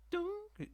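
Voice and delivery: high sung dunk jingle; achievement sound effect. Retold or imitated. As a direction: chime it like a game achievement. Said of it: "somebody just unlocked an achievement".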